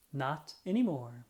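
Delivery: ordinary delivery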